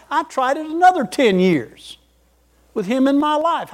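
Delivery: a pastor pressing his own story on the room; rising preaching fervor. Acted. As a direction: preach it with mounting conviction. Preaching builds to testimony.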